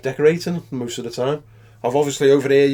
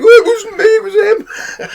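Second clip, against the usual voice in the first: much higher, louder, laughing protest of innocence; squeaky mock denial; 8 dB louder than usual. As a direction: plead not guilty in a silly high voice. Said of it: "he was like".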